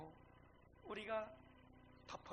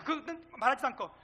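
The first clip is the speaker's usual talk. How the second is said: ordinary talk, nothing marked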